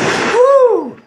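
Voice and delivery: very high, loud whoop; frenzied cowboy woo; wild whoop. Acted. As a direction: whoop like a crazed hunter. Whooping while shooting.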